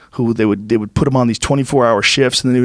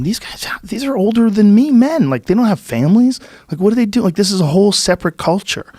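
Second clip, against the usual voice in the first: high-pitched, incredulous inner voice; baffled disbelief; 9 semitones higher than usual. Retold or imitated. Young man puzzling over older gamblers, incredulous. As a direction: voice baffled questions, pitched well up.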